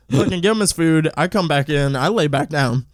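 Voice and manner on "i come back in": normal talking voice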